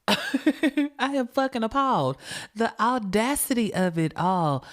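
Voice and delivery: outraged disbelief with a laugh; appalled. Real, not acted. Teller reacting to the killer taunting police, laughing in outrage. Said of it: "I am appalled".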